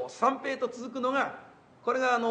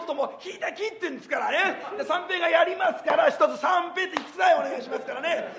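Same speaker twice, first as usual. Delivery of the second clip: breathless hyper comedian routine with laughs; Sanpei impression; 5 semitones higher than usual. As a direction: rattle on like the frantic comic, shouting bits. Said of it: "三平の舞台を一つここでちょっとやってみましょう".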